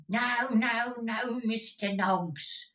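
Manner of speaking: high, slow fussy denial; old Gride. Acted. Old miser correcting Newman, high and drawn out. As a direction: wag a finger at him, slow and high.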